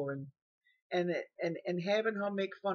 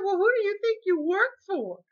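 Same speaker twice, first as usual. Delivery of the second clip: much higher, loud, near tears; shrill disbelief; 13 semitones higher, 8 dB louder than usual. Retold or imitated. Old woman challenging the caregiver, high and tearful. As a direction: demand it with a cracking voice.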